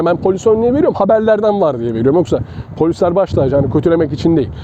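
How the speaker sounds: ordinary talk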